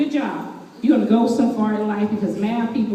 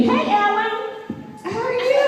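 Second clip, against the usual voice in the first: much higher, bright eager hello; eager greeting; 11 semitones higher than usual. Acted. Speaker playing himself greeting Ellen, eager and high. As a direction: greet her high and overly keen.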